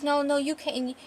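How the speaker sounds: high, distressed plea; desperate pleading. Acted. Husband begs his dying wife to hold on, desperate pleading. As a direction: plead with rising urgency.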